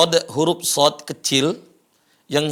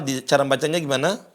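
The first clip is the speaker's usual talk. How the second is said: ordinary talk, nothing marked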